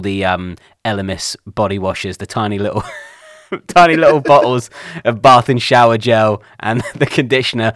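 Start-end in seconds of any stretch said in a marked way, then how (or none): 3.2-7.7 laughing through the words, a bit louder. Real, not acted. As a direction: giggle while confessing the little theft. Laughs over nicked toiletries.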